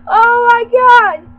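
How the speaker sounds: loud, high, helpless amusement; cracking up. Real, not acted. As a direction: cry it out, still reeling from laughter. Cracking up at the joke.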